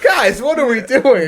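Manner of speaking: slow, high, put-on exasperation; mock exasperated. Worker mocking his crew to his friend, exasperated. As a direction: plead with the crew in disbelief. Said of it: "It's like".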